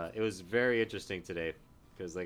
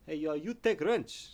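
higher, slow mock Serbian accent in broken English; 8 semitones higher, 3 dB louder, slower than usual. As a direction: imitate the Serbian coworker asking for his wrench back. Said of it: "he goes".

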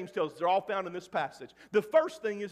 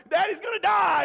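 high, loud panicked wail; panicked cry; 10 semitones higher, 7 dB louder than usual. Retold or imitated. Boy screaming in panic as his father can't breathe. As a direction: cry out that dad is dying.

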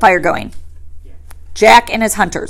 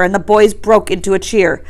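usual speaking voice

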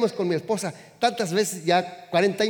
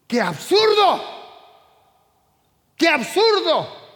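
much higher, loud outraged shout; 9 semitones higher, 5 dB louder, slower than usual. Acted. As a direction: blast out the absurdity, scandalized. Preacher denouncing materialism.

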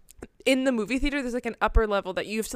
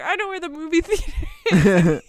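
put-on laughing kid quote; 5 semitones higher, 7 dB louder than usual. Kid quoted while running away.

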